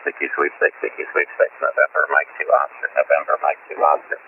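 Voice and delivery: low, gravelly recorded voice; rough, low playback of his call; gravelly recording. Real, not acted. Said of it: "your recording sounds more gravelly than normal".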